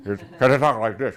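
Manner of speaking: words squeezed past a cigar in the mouth; dad's cigar-mouth voice. Retold or imitated. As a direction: imitate dad talking round a cigar. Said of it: "'cause of the cigar".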